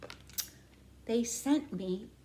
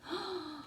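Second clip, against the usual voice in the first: surprised gasp; 6 semitones higher, 10 dB quieter than usual. Pretend. Surprise reveal in picture book.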